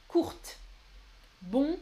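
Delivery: ordinary delivery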